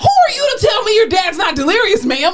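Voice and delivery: high-pitched, hammed-up argument with a relative; indignant comeback. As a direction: retell it as an outraged telling-off. Acted quarrel with patient's daughter.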